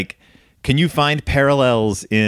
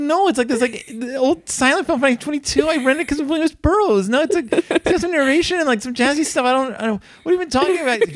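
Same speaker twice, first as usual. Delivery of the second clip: very high, squeaky hammed-up protest; mock-frantic explaining, voice way up high; flustered self-defence; faster than usual. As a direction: babble excuses like an accused teenager. Retold frantic explanation to dad.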